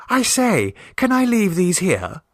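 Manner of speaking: high, bright-eyed niece bursting in; eager girl. Acted. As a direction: pipe up like an excited teenage girl. Vera bursts in with animals.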